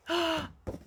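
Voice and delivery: a sharp mock gasp of surprise; storyteller gasp. Reading to child, gasping before the tallest animal. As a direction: gasp to build up the big reveal. Reveal of giraffe.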